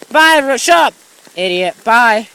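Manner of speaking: high, loud dismissive yell; loud "shut up!" and "bye"; angry shout. Acted. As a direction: snap them away. Shouting at the video maker.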